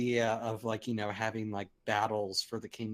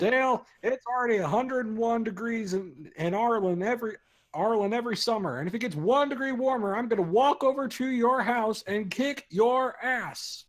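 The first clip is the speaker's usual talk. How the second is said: higher, louder Texan dad rant; Hank Hill voice; 10 semitones higher, 5 dB louder than usual. Retold or imitated. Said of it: "that was so good".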